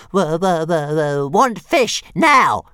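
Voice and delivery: high, loud stuttering demand; hissing little dragon. A narrator's reading. Hungry dragon hovering before Hiccup, loud stammering demand. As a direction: stutter up to an explosive, high final word. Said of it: "hissed Toothless".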